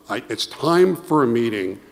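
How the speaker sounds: ordinary talk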